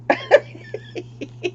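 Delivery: a high, rueful burst of laughter; high wry laugh. Real, not acted. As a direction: laugh at the irony. Laughs at doctor quote.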